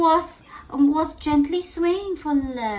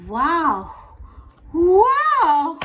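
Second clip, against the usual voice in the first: amazed wow; 4 semitones higher, 7 dB louder than usual. Acted. Amazed at Gerald's flip.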